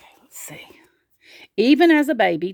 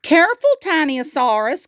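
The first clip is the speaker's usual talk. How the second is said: high, loud warning call; urgent raised-voice warning; 8 semitones higher, 4 dB louder than usual. A narrator's reading. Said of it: "called Triceratops".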